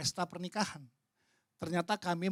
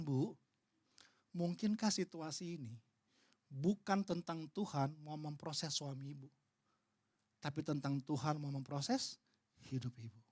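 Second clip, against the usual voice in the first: gentle; 4 semitones lower, 8 dB quieter than usual. Retold or imitated. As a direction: ask it gently to let it sink in. Pendeta menjawab dengan lembut. (pertanyaan lembut dan pelan)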